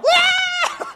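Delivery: high, loud imitated battle yell; mock war cry. Preacher spoofing the movie 300, mock war cry. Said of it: "the movie where you're like".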